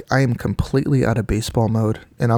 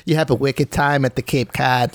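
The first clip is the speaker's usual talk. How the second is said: put-on Boston accent; 3 semitones higher than usual. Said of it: "say these in a Boston accent".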